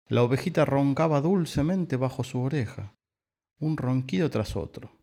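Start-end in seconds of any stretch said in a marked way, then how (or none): none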